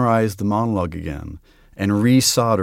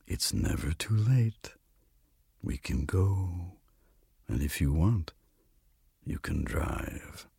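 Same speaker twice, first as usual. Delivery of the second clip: slow, soft, coaxing Death; hushed and unhurried, fading at the end; gentle invitation; 4 semitones lower, 11 dB quieter, slower than usual. A narrator's reading. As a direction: offer it softly, with long pauses. Death gently invites the lady along.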